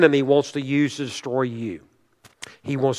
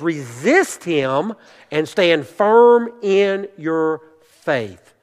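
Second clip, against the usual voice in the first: slow, drawn-out words, dropping on the last; emphatic exhortation; much slower than usual. Retold or imitated. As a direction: stretch out the command, pausing before the final word. Quotes Peter as emphatic exhortation.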